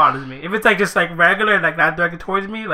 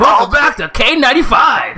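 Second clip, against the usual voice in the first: loud hyped radio host shout; 5 semitones higher, 4 dB louder than usual. Pretend. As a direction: belt it like a morning-show DJ. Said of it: "you sound like a radio disc jockey".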